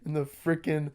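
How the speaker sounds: hammed-up outrage breaking into laughter; telling off the photographer who said smile, laughing mock anger. Retelling his angry retort.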